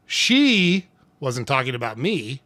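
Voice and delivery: "She" stretched out and set apart; slow down and lean hard on "She"; stress on SHE. Shown as a demonstration. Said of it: "emphasis on a different word changes a sentence".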